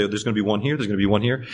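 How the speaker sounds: unbothered, gently pointing out waves; calm Jesus. Retold or imitated. Jesus voiced calmly.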